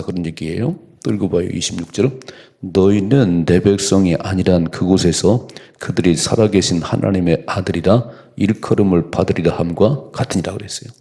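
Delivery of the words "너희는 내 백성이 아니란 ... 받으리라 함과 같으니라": louder, measured pulpit reading; scripture reading. Acted. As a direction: proclaim the verse to the congregation. Reads scripture aloud.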